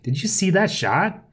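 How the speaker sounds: high, slow gloating excitement; victorious. A narrator's reading. Narrator shows off the shot to Johnny, victorious. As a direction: crow over your great shot. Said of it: "I raised my hands victoriously".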